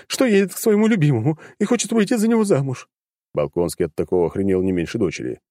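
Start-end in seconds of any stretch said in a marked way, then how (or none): 0.1-2.9 light sobbing. A narrator's reading. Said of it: "начала рыдать женщина".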